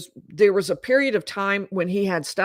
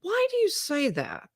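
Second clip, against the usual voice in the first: high, softer, curious questioning; 10 semitones higher, 5 dB quieter than usual. Retold or imitated. Husband on jail call questions his wife's denial, curious. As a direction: ask it lightly, pitched well up, puzzled. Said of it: "it's more curious, like".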